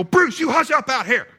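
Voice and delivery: very high hollered command; all-out yell. Retold or imitated. Man jumping out on the porch at his dog, all-out yell. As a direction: re-enact a full-force holler at the dog. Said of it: "as loud as I can".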